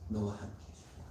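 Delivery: "너와 함께 해줄 거야" whispered tenderly, the stress on "너와"; whispered promise. Acted. God comforting the weak believer, in a whisper. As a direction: breathe "너와 함께 해줄 거야" softly like a secret. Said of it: "따뜻한 위로를 주신".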